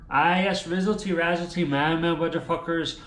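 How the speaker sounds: ordinary delivery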